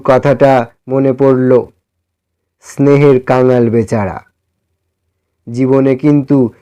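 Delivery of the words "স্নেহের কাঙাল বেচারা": usual speaking voice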